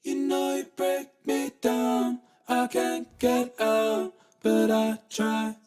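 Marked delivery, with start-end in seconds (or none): none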